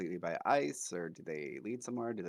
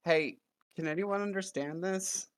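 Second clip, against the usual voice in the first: higher, louder in-character call; raised, high-pitched hail; 12 semitones higher, 5 dB louder than usual. Acted. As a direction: call out into the empty air for anyone. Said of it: "he's just gonna call out".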